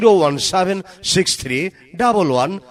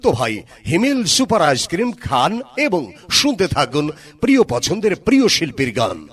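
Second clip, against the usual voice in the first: ad announcer; 5 semitones higher than usual. Acted. Loud mic ad promo.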